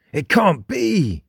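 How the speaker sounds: high, drawn-out cry of disbelief; panicked inner cry, pitched well up. A narrator's reading. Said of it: "panicked Jake in thought".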